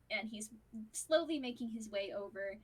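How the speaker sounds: usual speaking voice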